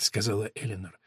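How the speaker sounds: ordinary delivery, nothing marked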